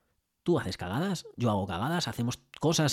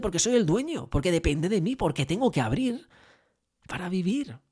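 insisting; 6 semitones higher than usual. Retold or imitated. Owner insisting to Fernando that he must open, emphatic. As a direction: push it like a man defending his living.

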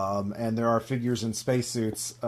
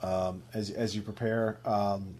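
ordinary talk, nothing marked

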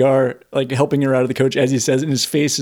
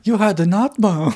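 Inga voice; 6 semitones higher than usual. Retold or imitated. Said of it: "she's like".

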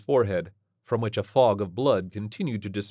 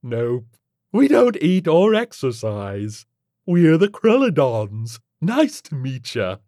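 loud, slow, higher alien voice; breezy alien creature; 7 semitones higher, 9 dB louder, slower than usual. Acted. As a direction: introduce your species with casual swagger.

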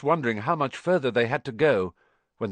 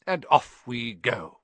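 Dumbledore; slower than usual. A narrator's reading. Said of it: "said Dumbledore".

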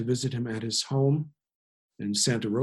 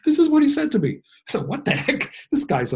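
higher, incredulous talk through laughter; laughing; 4 dB louder than usual. Real, not acted. Storyteller recalling the elder's blunt remark, laughing. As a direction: laugh in amazement as you speak.

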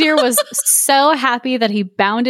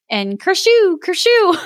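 two sneezy bursts, high and punchy; mock sneeze sound; sneeze noise; 9 semitones higher, slower than usual.

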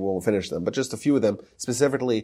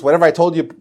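raised, higher announcing voice; imitated public announcement; 5 semitones higher, 5 dB louder, faster than usual. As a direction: announce it to the public as the rabbi would. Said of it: "he would announce".

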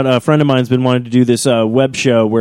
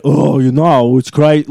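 exaggerated deep impression; much slower than usual. Shown as a demonstration. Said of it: "like, a really deep one".

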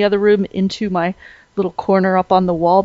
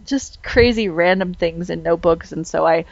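usual speaking voice

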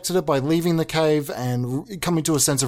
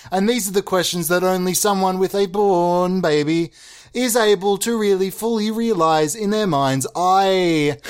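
ordinary talk, nothing marked

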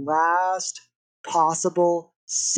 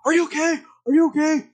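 much higher, anxious asking; frantic check on a friend; high-pitched worried friend; 10 semitones higher, 3 dB louder, faster than usual. Retold or imitated.

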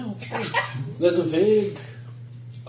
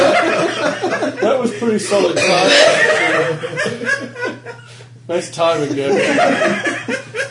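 laughing, louder praise; real laughter; 9 semitones higher, 7 dB louder, much slower than usual. Real, not acted. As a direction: praise the joke while laughing. Laughing at joke timing.

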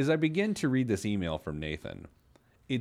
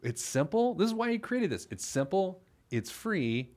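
infomercial voice; 10 semitones higher than usual. Pretend. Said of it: "now it sounds like an infomercial".

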